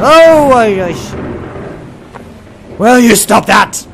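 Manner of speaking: high, loud startled cry into a scolding; yelp, then scold it loudly. Real, not acted. Player jumps at the lightning, startled high shout.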